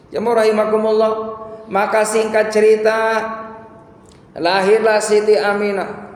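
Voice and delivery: high, loud sermon storytelling; impassioned preaching. Real, not acted. Preaches at full force.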